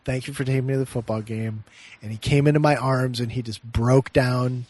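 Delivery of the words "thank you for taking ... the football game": ordinary talk, nothing marked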